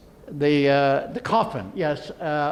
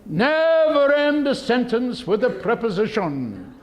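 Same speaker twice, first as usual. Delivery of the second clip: prim, raised voice of a pedant; pedantic old fart; 6 semitones higher than usual. Retold or imitated. Mocking a pedantic old fart scolding about grammar. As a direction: lecture it like a fussy old pedant. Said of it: "This pedantic old fart says".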